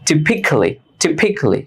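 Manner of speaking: Typically with silent a, typically as "tipikli"; typically said right. Shown as a demonstration. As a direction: say Typically as tipikli, dropping the a and one l. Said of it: "আমি এখানে উচ্চারণ করি নাই typically". (Typically উচ্চারণ টিপিক্লি, এ আর এল বাদ দিয়ে)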